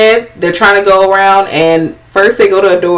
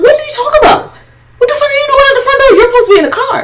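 very high mimicked disbelief; mock incredulous; much faster than usual. Voicing friends answering the walkie-talkie, high mock disbelief. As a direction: squeal the questions in a put-on voice.

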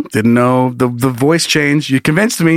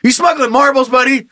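high-pitched mock interrogation; hemorrhoid hunter; 11 semitones higher than usual. Hunter frisking a man against a wall, mock demanding. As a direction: grill the suspect like an over-keen cop.

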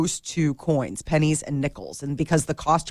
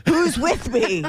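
rallying the crowd, higher pitch; angry mob leader; 7 semitones higher, slower than usual. Pretend. Joking rally cry.

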